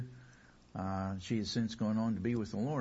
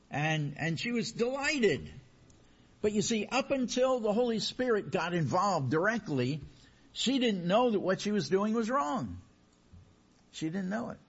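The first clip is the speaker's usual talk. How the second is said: higher, rising preacher's emphasis; preaching; 10 semitones higher than usual. Acted. Pastor driving the point home to his congregation, rising.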